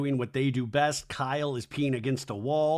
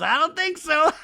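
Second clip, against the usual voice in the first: very high, louder teasing squeal; gleeful mock retort; 13 semitones higher, 6 dB louder than usual. [name] shoots down Jesse's question, high gleeful squeal. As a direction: squeal it high, tipping into a cackle.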